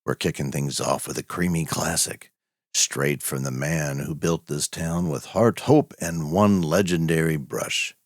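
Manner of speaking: honey-smooth radio host introducing the next tale; velvet radio voice. Acted. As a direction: croon it like a late-night velvet-voiced announcer. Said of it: "call him Velvet Voice Vernon, and you'll see why".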